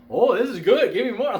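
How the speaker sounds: loud, high burst of mock excitement; hammed-up greedy delight, pitched high and loud; imitated delighted child. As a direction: act out a kid suddenly lighting up. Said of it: "she just lit up".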